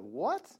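"What?" with a sharp upward swoop at the end; disbelieving "What?", pitch shooting up; incredulous question. Acted. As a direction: make "What?" a big rising question mark. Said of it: "What? with a big question mark".

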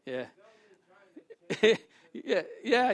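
ordinary delivery

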